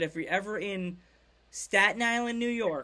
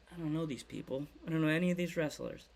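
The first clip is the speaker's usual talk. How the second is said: low, soft aside to himself; hushed, lower retold self-talk; unsure inner thought; 4 semitones lower, 6 dB quieter, faster than usual. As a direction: voice a newcomer's private thought, quietly. Retelling his own lost newcomer thoughts.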